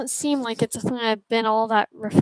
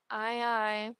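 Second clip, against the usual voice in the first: much softer acknowledgement; subdued reply; 9 dB quieter than usual. Acted. Crew member answering the captain's orders, subdued.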